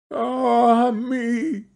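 high, drawn-out wail; anguished cry. A narrator's reading. Guilty wife hears the bird's song, anguished cry. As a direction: lament with a high, held voice. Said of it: "cried the wife".

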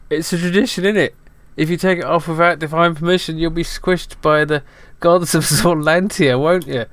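lightly voiced cockney hat; cheeky talking hat. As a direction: play the bossy hat catching you. Hat character answering.

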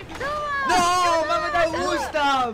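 raging gamer. Real, not acted. Killed in game.

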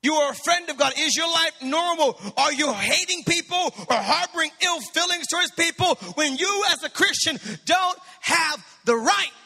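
rising preacher's cadence, building to a shout; fervent pulpit appeal; preaching. Acted. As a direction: press the question on the congregation. Preaching climax to congregation.

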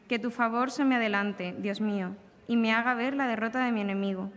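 ordinary talk, nothing marked

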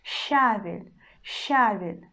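щавель with soft щ then plain а; soft щ demo. Shown as a demonstration. Teacher repeats щавель for learners, soft щ. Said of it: "So we say щавель".

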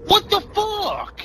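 high, slow incredulous outburst; raging gamer. Real, not acted. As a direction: stretch it out in disbelief. Player cut again.

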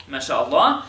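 ordinary talk

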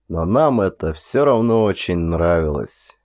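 usual speaking voice

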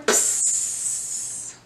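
breathy psst of air brakes; bus door hiss. Shown as a demonstration.

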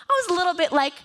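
normal talking voice

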